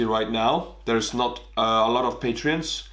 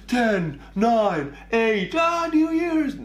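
higher and higher, cheering the countdown; party countdown; 11 semitones higher, much slower than usual. Pretend. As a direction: count down like a crowd at midnight, ending in a cheer. Mock New Year countdown shout.

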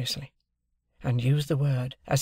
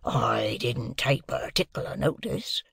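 dubious; slower than usual. A narrator's reading. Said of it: "he said dubiously".

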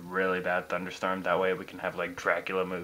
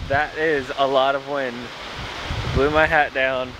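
loud, raised voice against the wind; shouting over wind; 5 semitones higher, 6 dB louder than usual. Real, not acted. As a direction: call out loud over the gusts. Talking outdoors in strong wind.

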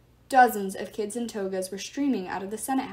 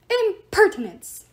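high, loud, slow furious cry; outraged; 10 semitones higher, 10 dB louder, slower than usual. Acted. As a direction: explode with offended outrage. Statue god outraged.

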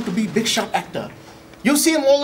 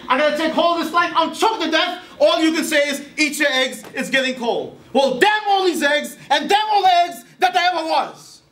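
theatrical outburst; 5 semitones higher than usual. Retold or imitated. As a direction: rage at the eggs like a stage actor. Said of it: "She say, man say".

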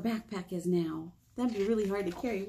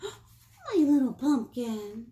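gasp, then slow, louder, doting words; fond greeting; 3 semitones higher, 4 dB louder, much slower than usual. Acted. Dad picking up daughter, slow doting pet name with a gasp. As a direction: dote on her as he arrives.

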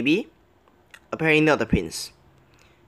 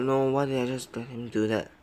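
usual speaking voice

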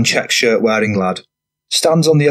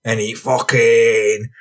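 rough lad voice; slower than usual. A narrator's reading. Franny character voice.

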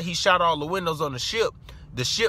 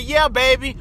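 a hint of a sweet, higher hostess; 6 semitones higher, 4 dB louder than usual. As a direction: lightly voice the lady, higher and brighter. Homeowner answers her question.

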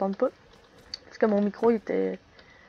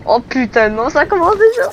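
loud panicked outcry; yelling in sudden panic; panicking gamer; 3 semitones higher, 12 dB louder than usual. Real, not acted. Gamer panics as round starts.

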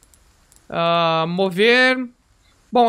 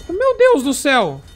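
startled cry; 6 semitones higher, 5 dB louder, slower than usual. Real, not acted. Sudden monster appears in game.

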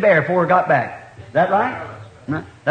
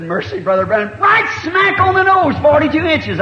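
amazed exclaim; 9 semitones higher than usual. Retold or imitated. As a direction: stretch it out, the measurement astonishes you. Bud amazed at the measurement.

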